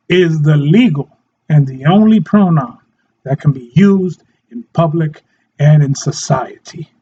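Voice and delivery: normal talking voice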